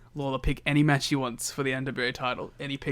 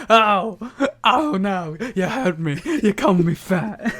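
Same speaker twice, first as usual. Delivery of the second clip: higher, louder hammed-up wounded whine with a laugh; mock hurt Dusty; 6 semitones higher, 7 dB louder, slower than usual. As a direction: play fake offense for laughs. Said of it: "And then Dusty's like".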